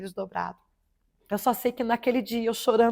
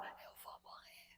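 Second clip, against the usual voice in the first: starts as a voiceless whisper, then thin faint voice; whispering, losing her voice; 33 dB quieter than usual. Shown as a demonstration. Showing the host how she could barely speak after surgery. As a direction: rasp out the words as if speech hurts. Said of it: "era assim que eu falava".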